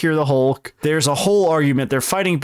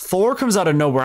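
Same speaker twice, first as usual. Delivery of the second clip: ordinary delivery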